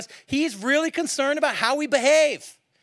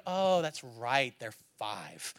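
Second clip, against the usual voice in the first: slow, softer dawning thought; soft realization; 10 semitones lower, 8 dB quieter, much slower than usual. Retold or imitated. Coach realizing the kids are only five, slow and soft. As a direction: let it dawn on you slowly. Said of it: "And then I go".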